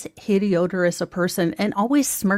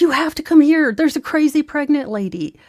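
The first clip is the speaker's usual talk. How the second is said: high and loud breathless urging; excited urging; 7 semitones higher, 4 dB louder than usual. Retold or imitated. Coworker urging Mo to come see, high excited voice. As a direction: hurry her along, thrilled by the gossip.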